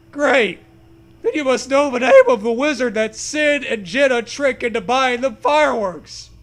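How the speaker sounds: hammed-up test question; loud quizzing ghost horse; mock quizmaster.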